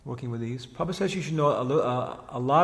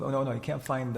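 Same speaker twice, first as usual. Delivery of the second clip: ordinary delivery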